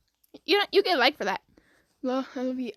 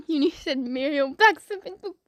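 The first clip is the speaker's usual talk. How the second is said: ordinary talk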